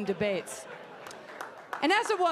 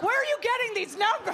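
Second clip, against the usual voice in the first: incredulous; 8 semitones higher than usual. Retold or imitated. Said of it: "I was like".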